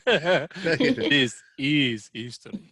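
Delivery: put-on slow, drawn-out French accent; laughing, hammy French-accented line. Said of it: "Nice French accent there".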